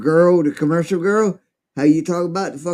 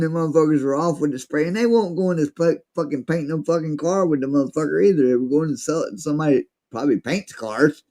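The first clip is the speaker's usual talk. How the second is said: usual speaking voice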